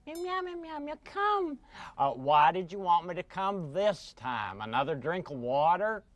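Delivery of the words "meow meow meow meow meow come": meowing cat-puppet voice; pussycat puppet. Acted. Cat calling a friend to come, meowing cat voice. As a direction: talk as a little cat, meows between the words.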